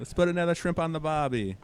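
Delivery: a slightly better hammed-up Aussie accent; mock Australian accent. Retrying the Aussie line after being told it was bad. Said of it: "It's getting better".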